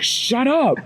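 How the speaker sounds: high-pitched retold exasperation; flustered hushing. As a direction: act out a harried dad cutting the kid off. Snapping at daughter while cleaning.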